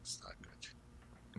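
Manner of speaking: slow low whisper. Real, not acted. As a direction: breathe it out slowly. Whispered verdict on a show.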